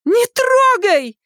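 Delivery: high, drawn-out cry; screaming. A narrator's reading. Daughter stops father taking her packet, screaming. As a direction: cry out to stop him. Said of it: "закричала она".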